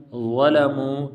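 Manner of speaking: ظلموا opens on a heavy, rounded th; thickened, drawn-out ظلموا; thick letter. Shown as a demonstration. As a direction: recite ظلموا slowly, first letter full-mouthed. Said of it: "پر موٹا پڑھتے ہیں".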